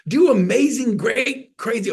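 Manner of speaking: high, loud hyped-up call; shouting encouragement, pitch soaring; excited. Real, not acted. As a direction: pump them up to dream big.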